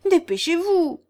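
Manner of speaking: high, loud impatient order; urgent cry. A narrator's reading. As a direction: cry out to hurry them along. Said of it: "s'écria Guillaume".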